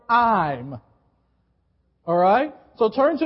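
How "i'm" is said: "I'm" set apart with a firm falling tone; stress on I'M. Shown as a demonstration. Preacher coaching the congregation on the right word, stressing "I'm". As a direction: land hard on "I'm", pitch dropping. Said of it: "emphasize the word".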